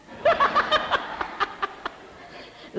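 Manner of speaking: high airy burst of laughter; breathy high laugh. Real, not acted. Preacher laughing at his own cheeky reply to father. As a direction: laugh out at the memory.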